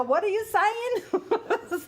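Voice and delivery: high, slow, puzzled voice with a laugh; amused imitation of a puzzled American; mock confused listener. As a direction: play someone who can't understand you, laughing. Mimicking confused listeners abroad.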